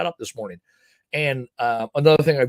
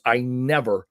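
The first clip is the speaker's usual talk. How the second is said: usual speaking voice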